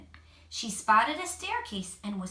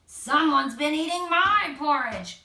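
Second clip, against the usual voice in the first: louder yell, pitch near normal; yelling Papa Bear; 4 semitones higher, 8 dB louder than usual. A narrator's reading. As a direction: bellow at the empty bowl. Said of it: "Papa Bear yelled".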